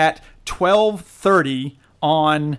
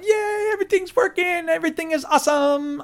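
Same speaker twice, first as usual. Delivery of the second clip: much higher, mock-giddy cheer; giddy joy; 12 semitones higher than usual. Retelling his happy shower moment, giddy and high. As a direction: chirp it like an overjoyed kid. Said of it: "I'm like".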